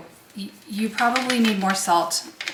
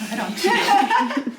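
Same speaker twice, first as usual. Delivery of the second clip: laughing; 10 semitones higher, 4 dB louder, slower than usual. Real, not acted. Laughs at own preheated oven joke.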